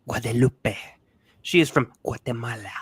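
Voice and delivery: "Guadalupe" and "Guatemala" in exaggerated Spanish pronunciation; mock over-careful Spanish on "Guadalupe" and "Guatemala"; over-enunciated Spanish names. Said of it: "to over enunciate".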